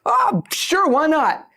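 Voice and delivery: high casual comedian. Retold or imitated. Comedian casually accepts offer.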